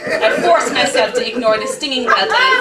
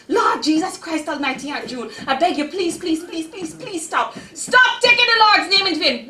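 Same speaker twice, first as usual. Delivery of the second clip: put-on high, pleading cries of a frightened child; desperate begging; 7 semitones higher than usual. Girl begs Aunt June to stop the beating, frightened. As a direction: beg her to stop, voice up high.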